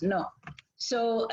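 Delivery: ordinary talk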